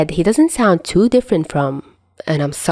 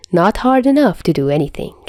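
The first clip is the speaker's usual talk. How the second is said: plain reading voice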